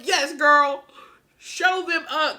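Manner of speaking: usual speaking voice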